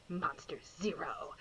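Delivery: whispered Monster Zero; ominous hush. Acted. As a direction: drop to a dread-filled murmur.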